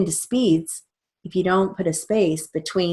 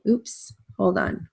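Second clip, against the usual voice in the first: ordinary delivery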